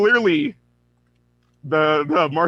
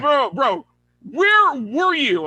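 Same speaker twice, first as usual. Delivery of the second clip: slow, high, pleading "where were you"; pleading Batman; 5 semitones higher, much slower than usual. Retold or imitated. Batman pleads with the Martian Manhunter, slow and high. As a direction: drag out the plea like a stunned friend.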